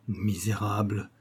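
slow, softened word of contempt; quivering murmur. A narrator's reading. Mademoiselle left speechless after Doman walks off, murmuring. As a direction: murmur it slowly, with understated outrage. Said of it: "murmura-t-elle toute frémissante".

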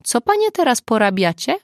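a touch of alarm; growing unease. A narrator's reading. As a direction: ask as if dreading the answer. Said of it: "zapytał z rosnącym niepokojem".

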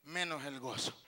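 ordinary talk, nothing marked

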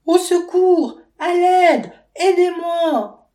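high, loud desperate screams; crying for help. A narrator's reading. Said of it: "elle entend sa belle-mère crier".

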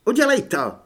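high, loud sharp challenge; barked dare. A narrator's reading. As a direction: bark at him to go ahead. (vyštěknutá výzva)

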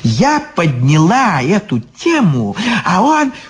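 high indignant crone; Baba Yaga voice. Acted. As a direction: complain like an old witch.

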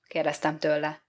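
plain reading voice

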